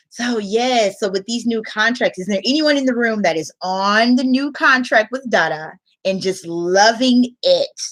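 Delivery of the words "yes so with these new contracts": ordinary talk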